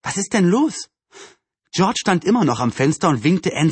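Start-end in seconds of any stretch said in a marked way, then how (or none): 0.0-0.9 higher, drowsy, rising question; sleepy question. A narrator's reading. Anne rubs her eyes after waking, sleepily asking. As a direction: ask as if just woken. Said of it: "Verschlafen rieb sich Anne die Augen".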